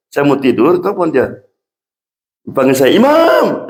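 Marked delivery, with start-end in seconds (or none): 3.0-3.7 calling out. Retold or imitated. Mother calling him on phone.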